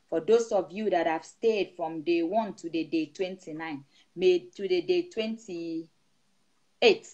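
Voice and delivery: steady, rhythmic prayer over the flock; prayerful blessing. Acted. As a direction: pray over the congregation with rising fervour. Pastor praying over the congregation.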